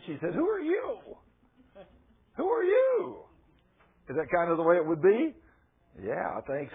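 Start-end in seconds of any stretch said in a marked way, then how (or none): 0.3-3.3 high, loud startled wife demanding who he is; shocked wife. Retold or imitated. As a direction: play the stunned wife at the door, much higher. Wife confronting absent husband.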